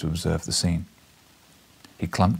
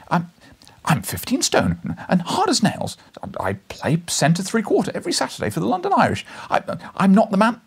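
higher, stuttering bravado; stammering young boast; 10 semitones higher than usual. Acted. Reporter warning Challenger of his strength, high and stammering. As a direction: puff up but trip on the words.

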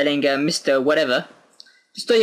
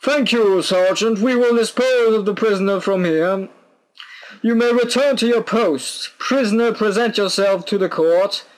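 formal court official; 8 semitones higher than usual. Acted. Official summoning the prisoner to court, high and commanding. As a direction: proclaim it in a raised, starchy voice.

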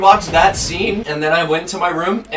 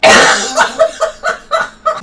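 laughing so hard breath runs out; breathless laughter; 6 semitones higher, 4 dB louder than usual. Real, not acted. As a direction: wheeze out the words. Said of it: "I'm gonna run out of breath".

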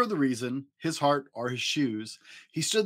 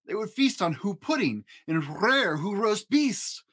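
higher, playful read of the feast; lifted storybook voice; 7 semitones higher than usual. A narrator's reading. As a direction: savour the silly food names. Gleeful list of food.

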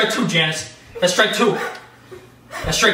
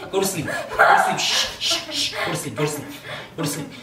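very soft, low, whispery shushing; mock soothing hush; 5 semitones lower, 6 dB quieter than usual. Winner pinning opponent, playfully hushing them to sleep.

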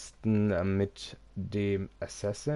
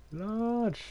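high squeal; 14 semitones higher than usual. Acted. High squeal reading slider.

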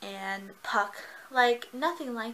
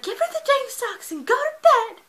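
high, loud grumpy voice, much higher; exasperated Chubbs; 14 semitones higher, 9 dB louder than usual. Retold or imitated. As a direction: play the fed-up friend yelling from the back. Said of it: "Chubbs is in the background just like".

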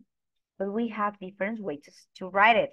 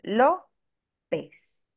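"López" split as LO, pause, pez; teacher's broken-up "López" with stressed LO; stress on first syllable. Shown as a demonstration. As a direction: lean on the O of "López", then let the ending fall. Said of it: "In that vowel, we do an intonation".

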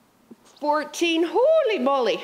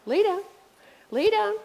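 rising dog command; 8 semitones higher than usual. Real, not acted.

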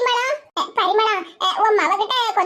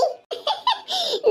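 high-pitched, soft chuckle; light laugh up high; 7 semitones higher, 6 dB quieter than usual. Acted. Friends agreeing to settle the matter, amused laugh.

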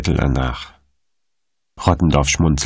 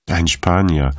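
ordinary delivery

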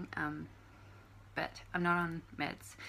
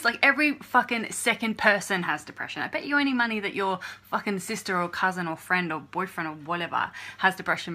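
loud, furious rant at the trolls; angry at trolls; 3 semitones higher, 11 dB louder than usual. Real, not acted. To the trolls watching the channel, a loud angry rant. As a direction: yell it at the trolls, fed up.

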